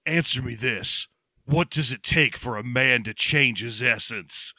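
low, slow pompous martial artist; mock-solemn philosopher. Martial artist posing a deep question to beaten foe, pompous. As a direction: pose it gravely, low and deliberate. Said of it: "So he goes to Mike Starr and he's like".

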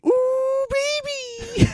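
extremely high, soft squeaking croon. Pretend. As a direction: go as high as the voice goes. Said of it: "Increasingly high pitch".

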